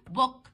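"بوك" with a light voiced b. Shown as a demonstration. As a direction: say "بوك" easily, lips barely pressed. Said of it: "بنطقها عادي خفيفة قوي". ("بوك" بباء عربي خفيفة)

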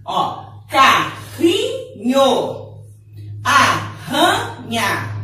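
carrinho and arranha said very slowly with a strong guttural R; strong double R. Shown as a demonstration. Teacher showing pupils the double R sound, slowly. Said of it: "Som forte".

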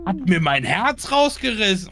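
high and loud, shocked; panicking gamer. Real, not acted. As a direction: blurt it out in shock. Ghost kills player. (panischer Gamer)

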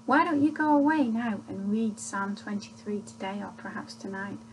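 usual speaking voice

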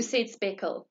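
ordinary delivery, nothing marked

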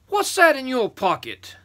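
high, loud giant's question; demanding giant. Acted. Third giant questioning the first, demanding. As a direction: boom it out as a big giant.

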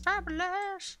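Fabulous. sung up high and drawn out; squealed fabulous. Pretend. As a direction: leap way up on Fabulous. Repeated catchword, pitch leap.

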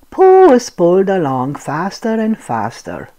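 ordinary delivery, nothing marked

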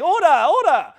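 loud high cry of order; imitating the Speaker. Preacher mimics Speaker calling the house to order. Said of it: "a guy in a big seat saying".

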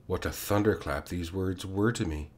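usual speaking voice

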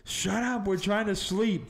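shrill-free mock old lady telling him to shut up; annoyed grandma. Grandma, woken at night, snaps at the screaming boy. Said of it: "my grandma goes".

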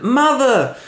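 high-pitched cry for mother; shocked Hamlet. Acted. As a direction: call out in comic horror. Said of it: "And Hamlet".

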